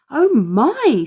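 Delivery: impressed. A narrator's reading. Mum marvelling at the van's hidden cooker, impressed. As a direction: marvel at the clever van.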